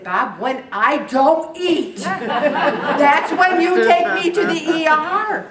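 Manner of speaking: exasperated insistence. Retold or imitated. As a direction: hammer it home, drawn out and laughing. Telling husband when to go.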